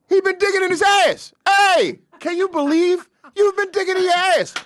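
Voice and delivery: very high, loud, amazed shouting; can't believe the news, shout it out; surprised. Pretend. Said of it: "Now say it's surprised".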